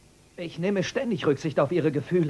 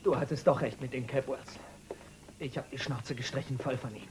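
whispered in disgust; bitter whisper; 4 semitones lower, 6 dB quieter than usual. Acted. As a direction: spit it out under his breath. Bitter confession to his father. (verbittert flüsternd)

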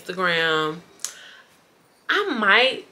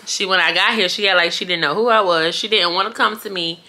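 usual speaking voice

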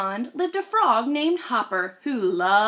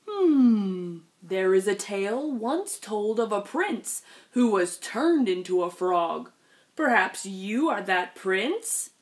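put-on wise old pond elder; 2 semitones lower than usual. Same elder voice continues.

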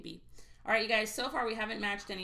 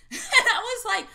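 laughing; 12 semitones higher, 11 dB louder than usual. Real, not acted.

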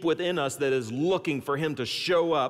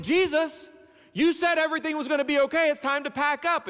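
higher, fast, insistent plea; urgent eager disciples; 9 semitones higher than usual. Acted. Disciples urging Jesus to pack up, higher and fast. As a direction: hurry him along, can't wait to go.

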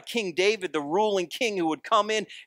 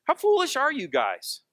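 higher, teasing sarcastic jab; 9 semitones higher than usual. Retold or imitated. Jesus teasing the disciples, lightly sarcastic. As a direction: needle them with mock scorn. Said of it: "listen to the sarcasm in Jesus' voice".

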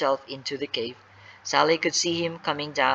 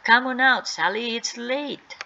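yelled call; 7 semitones higher, 4 dB louder than usual. Acted. Boys to missing Sally, yelling out for her. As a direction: call out higher, stretching the last words. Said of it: "They yelled again".

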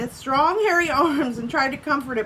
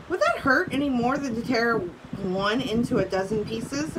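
usual speaking voice

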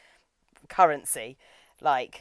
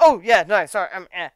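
loud start, then falling away; mock flustered backtracking; 7 dB louder than usual. Groundskeeper after letting slip about Mario, mock flustered. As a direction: imitate someone hastily covering a slip. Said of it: "but immediately shuts up".

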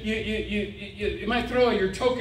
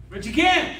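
ordinary delivery

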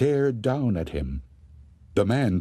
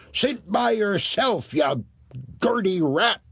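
put-on shouting old woman; 10 semitones higher than usual. Wife shouting back at the ghost, high scornful voice. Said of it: "she shouted".

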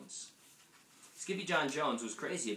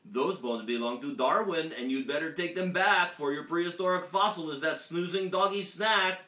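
loud, raised voice of a scolding story mother; scolding mama; 6 semitones higher, 6 dB louder than usual. A narrator's reading. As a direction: tell the kitty off firmly.

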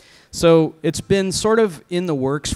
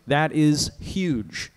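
drawn out; much slower than usual. Real, not acted. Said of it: "I'm sorry".

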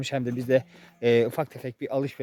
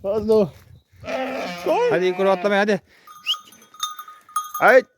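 calling out; 8 semitones higher, 6 dB louder than usual. Real, not acted. Urging the goat to jump.

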